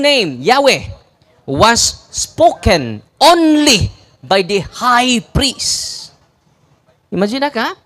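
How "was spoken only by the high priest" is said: slow deliberate repeat. Shown as a demonstration. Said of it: "Balikon ako ha".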